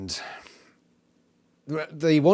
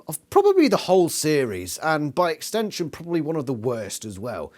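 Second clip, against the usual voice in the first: ordinary talk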